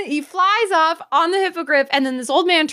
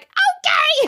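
high, squeaky mock surrender; comic high-pitched okay; reluctant giving in; 11 semitones higher than usual. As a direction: cave in with a pitched-up whine. Hero voiced giving in.

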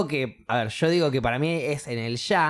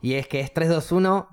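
normal talking voice

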